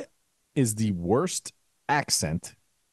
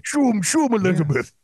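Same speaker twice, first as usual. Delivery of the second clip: high, loud Cajun shout; Troy from Swamp People; 8 semitones higher, 4 dB louder than usual. Retold or imitated. Impersonating Troy from Swamp People in Cajun accent. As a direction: holler it like the Swamp People gator hunter. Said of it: "Troy from the Swamp People".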